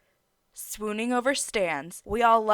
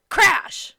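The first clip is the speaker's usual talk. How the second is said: sudden high, loud burst; shouted sound effect; 11 semitones higher, 11 dB louder than usual. A narrator's reading. Streamer acts out the car crash, high shout. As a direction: yell the impact out.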